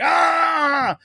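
high pained yell; anguished scream. Retold or imitated. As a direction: cry out in raw anguish. Imitating Superman's grief scene.

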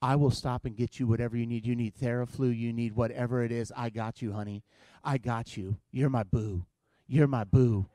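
low, soft and doting; doting boyfriend. Retold or imitated. Boyfriend soothing his sick girlfriend, low and tender. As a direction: coo like an eager new boyfriend. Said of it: "when you're dating".

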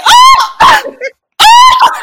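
high, loud startled shriek "Oh!"; sudden high shriek of alarm. Retold or imitated. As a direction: shriek in shock. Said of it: "I shrieked".

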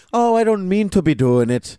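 high, laughing mock-Irish accent; Lena's Irish accent. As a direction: put on a hammed-up Irish lilt while giggling. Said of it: "make fun of, like, her not American accent".

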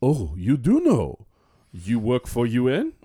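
slow, deliberate imitation of a Chadian accent; imitated Chadian accent. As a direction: play a surprised Chadian man in broken English. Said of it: "And he goes".

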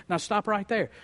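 ordinary talk

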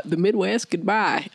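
a hint of Southern twang; Southern accent. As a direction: slip into a half-convincing Southern accent. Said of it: "said in a Southern accent".

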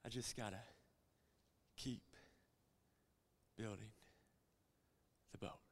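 Noah quietly steeling himself; quiet resolve. Retold or imitated. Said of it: "he's just going".

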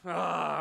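loud reluctant "ah"; exasperated groan. Retold or imitated. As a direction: groan as conscience catches you. Retold reluctant pang of conscience.

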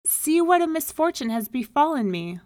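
loud, high lament, piling up the troubles; desperate cry. A narrator's reading. Poor man listing his misfortunes to the rabbi, loud desperate cry. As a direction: wail your complaints at full voice. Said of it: "he cried".

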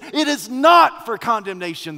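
"not" punched out loud; stress on not. Shown as a demonstration. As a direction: put heavy, bold emphasis on "not". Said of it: "as if he is bolding that word".

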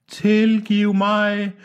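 very slow, high, held-out call; long-drawn pleading cry. A narrator's reading. Departing man begging forgiveness from afar, drawn-out high cry. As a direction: stretch each syllable as if drifting away. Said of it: "råbte han".

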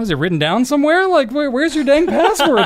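put-on a fed-up dad pressing the kids for the password; exasperated dad. Dad exasperated at kids' login.

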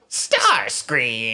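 drawn-out cartoon villain cry; hammed-up roar, stretched across both syllables; villain's bellow. Calls out villain's name in character.

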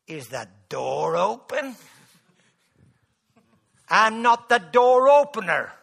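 loud, ringing pulpit preaching; full force preaching. Acted. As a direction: preach it out to the whole room.

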